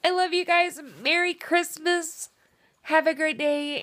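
real tears creeping into the farewell; tearful goodbye. Real, not acted. Thanking viewers while starting to cry again, tearful. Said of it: "I'm gonna start crying again".

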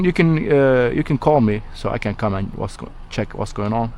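ordinary talk, nothing marked

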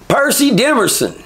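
high, called-out name like a guard reading a roll; calling out a name. Retold or imitated. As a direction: call the name up high across the yard.

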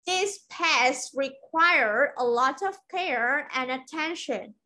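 slow, connected model sentence; teacher reading the full sentence for learners; whole-sentence model. Shown as a demonstration. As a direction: run the chunks together, still slow and careful.

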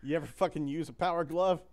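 ordinary talk

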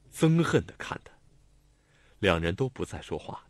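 plain reading voice

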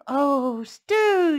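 high, loud longing oh; officer craving the stew; mouth watering. Acted. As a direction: say it hungrily. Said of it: "she said, and her mouth watered".

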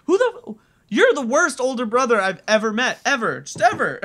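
high-pitched, exasperated rant; exasperated scolding. Real, not acted. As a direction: scold him in comic despair. Ranting at the older brother.

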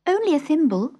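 put-on high girl voice; a small, high Alice. A narrator's reading. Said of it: "replied Alice".

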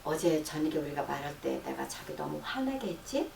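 soft, quiet apology to wife; tender coaxing question; gentle demo line. Shown as a demonstration. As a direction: speak softly to an upset partner. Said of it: "예를 들어서 여보".

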